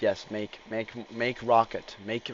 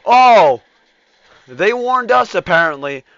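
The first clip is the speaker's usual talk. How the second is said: loud startled cry; surprised shout; 11 semitones higher, 21 dB louder than usual. Real, not acted. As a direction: burst out in shock.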